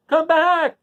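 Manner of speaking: Dorothy left behind, screaming up at the balloon; screamed. A narrator's reading. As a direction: scream after the rising balloon. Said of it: "she screamed".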